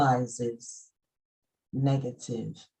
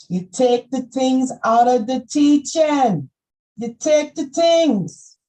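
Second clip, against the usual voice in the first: raised, loud, high preaching voice; 8 semitones higher, 9 dB louder than usual. Acted. Preachy Jamaican-style rant.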